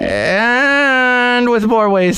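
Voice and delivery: high, slow, crying promo read; dramatic announcer. Acted. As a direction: stretch it out like a promo.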